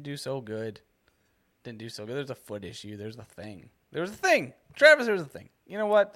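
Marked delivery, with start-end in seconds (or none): 3.0-3.7 low, muttered under breath; quiet mutter. Acted. Repeats line, building to a yell. 3.9-6.1 louder and higher, exasperated yell. Acted. Repeats line, building to a yell.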